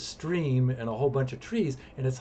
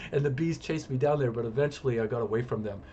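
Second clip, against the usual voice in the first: ordinary delivery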